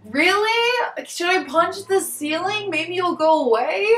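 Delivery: fed-up sarcasm at the noise; exasperated. Real, not acted. Vlogger complaining about the man stomping on the roof, sarcastic. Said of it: "annoyed at roof noise".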